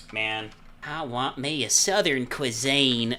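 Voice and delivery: slow Southern drawl; Southern accent warm-up. Shown as a demonstration. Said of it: "Okay, there we go".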